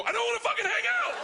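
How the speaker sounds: high-pitched, fast acted outburst; angry old man yell. Old man answering the knock and scratch, angry yell. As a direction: yell it through a door, imitating a fed-up neighbor.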